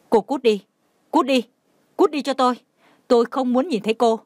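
high, forceful dismissal; angry. A narrator's reading. Aunt throws niece out, sudden anger. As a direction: drive her away angrily in short bursts. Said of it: "Bà thím đột nhiên tức giận".

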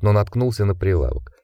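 usual speaking voice

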